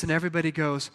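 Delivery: ordinary delivery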